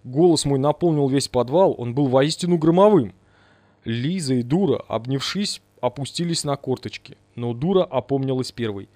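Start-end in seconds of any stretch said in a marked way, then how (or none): none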